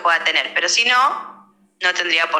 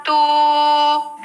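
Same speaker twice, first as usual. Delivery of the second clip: a long, high hooting toot; long train horn; 6 semitones higher than usual. Shown as a demonstration. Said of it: "que lo hago con la voz". (bocina larga de tren, aguda y sostenida)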